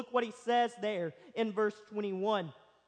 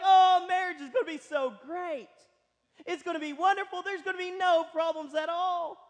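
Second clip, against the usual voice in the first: higher and louder, gushing with starry-eyed excitement; giddy newlyweds; 7 semitones higher, 4 dB louder than usual. Retold or imitated. Newlyweds with rose-colored glasses, gushing excitedly. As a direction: bubble over like a newlywed in love. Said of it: "and they're like".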